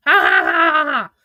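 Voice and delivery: hammed-up fake cackle; mock laughter. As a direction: voice the typed ha-has as a fake laugh. Reading out typed laughter.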